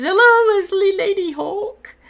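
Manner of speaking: high, loud, sing-out hello; hammed-up squealed greeting with laughter in it; playful greeting. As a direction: read it out in a high, amused voice. Reads a viewer's playful greeting aloud.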